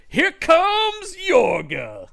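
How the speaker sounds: high, very slow dragged-out shout; slow-motion voice. Pretend. Mock dramatic slow-motion entrance.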